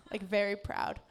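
ordinary talk

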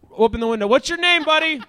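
loud, high holler up to a window; shouting. Real, not acted. Comedian hollers up to a man on the third floor, shouting. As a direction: call up to someone on the third floor. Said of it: "why am I shouting".

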